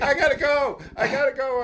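loud, high-pitched urgent plea to leave; overwhelmed retold outburst. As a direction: blurt it out high and loud, desperate to escape. Retold tearful escape from the hug.

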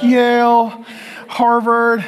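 loud, high, drawn-out college name-drop; boastful announcing. Retold or imitated. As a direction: proudly call out the schools, mock-grand. Mimics classmates showing off colleges.

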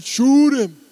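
Troy. Retold or imitated. Preacher imitating the hooked-alligator moment on TV, yelled holler. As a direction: holler it like a swamp alligator hunter. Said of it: "this guy Troy starts yelling".

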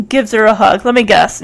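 ordinary delivery

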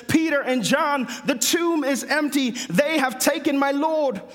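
put-on Peter and John cried out in a London accent; urgent plea in a London accent. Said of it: "I just did a London accent".

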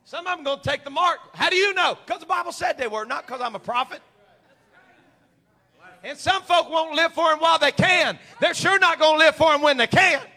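high, loud, forceful preaching. Real, not acted. Preacher warning some will take the mark, shouted preaching. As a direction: drive it home from the pulpit.

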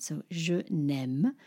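"je n'aime" slow, elided je n'aime; French demo. Shown as a demonstration. Teacher shows the elision to learners, slow and careful. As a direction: glide je into n'aime, slowly. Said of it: "has a vowel at the beginning".

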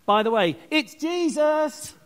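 high, stretched-out teasing reminder; playful Paul afterthought. Acted. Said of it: "And he says to them".

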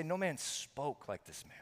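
"spoke" drawn out with a long opening s, in awe; stress on spoke. Retold or imitated. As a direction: linger on "spoke" as the marvel. Contrasts speech with raising the dead, healing.

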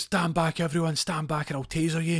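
loud high threat to the crowd; high threatening yell. Retold or imitated. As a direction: bark it at the crowd. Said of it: "He goes".

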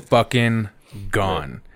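slow, stretched fucking gone; slow drawn punchline. Real, not acted. Retelling the dog bolting, slow drawn-out. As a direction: draw it out for the laugh.

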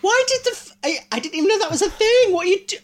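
high, laughing disbelief; incredulous laughing disbelief. Real, not acted. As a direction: react in stunned, giggling shock. Shocked by homemade fang story.

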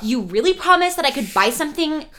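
high, loud whining brat; whiny Draco. Retold or imitated. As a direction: whine like a spoiled kid. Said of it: "Draco's like".

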